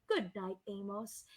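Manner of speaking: low hushed goodnight; soft sleepy goodnight. Acted. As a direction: say it drowsily, barely there. Elephant says goodnight.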